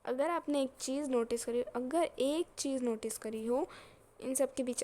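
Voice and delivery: loud, emphatic prompting; insistent teacher. Real, not acted. Teacher asking if students noticed one thing, loud and insistent. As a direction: raise your voice to make them notice.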